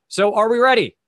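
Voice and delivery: rally the players to start; excited host call. Real, not acted.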